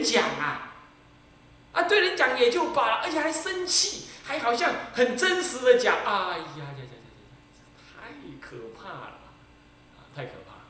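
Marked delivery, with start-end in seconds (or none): none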